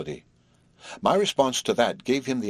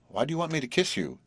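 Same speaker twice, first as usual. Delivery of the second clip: higher-pitched, intent question; 7 semitones higher than usual. A narrator's reading. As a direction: ask as if the answer matters. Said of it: "he asked intently".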